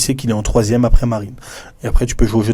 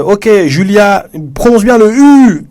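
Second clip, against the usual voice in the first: much higher, louder and slow; teacher prompting a student; 9 semitones higher, 5 dB louder, slower than usual. Retold or imitated. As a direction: coax Julia to say the U properly. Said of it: "Et après, tu dis". (professeure qui encourage Julia, voix haute, forte et lente)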